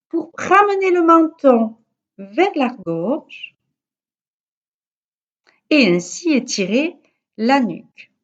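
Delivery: ordinary delivery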